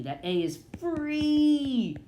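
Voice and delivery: FREE held long, high and loud; soaring, stretched "free"; jubilant FREE. Shown as a demonstration. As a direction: belt out FREE like a release. Teacher celebrates freed vowel.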